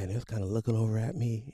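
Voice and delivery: ordinary delivery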